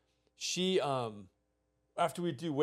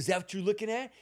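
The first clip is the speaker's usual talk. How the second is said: higher-pitched imitated challenge; mock menacing taunt; 7 semitones higher, 4 dB louder, faster than usual. Boyfriend confronts boy caught looking, higher taunting voice. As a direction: confront the kid caught spying.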